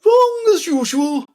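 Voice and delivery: very high, loud queen voice; loud, high dismissal; scornful Red Queen. A narrator's reading. Red Queen correcting Alice's answer, scornful. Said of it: "said the Red Queen".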